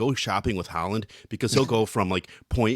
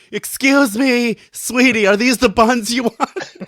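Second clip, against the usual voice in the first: high, loud, drawn-out mock sweet call; 11 semitones higher, 6 dB louder, slower than usual. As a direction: sing it out across the store. Calling out to friend in store.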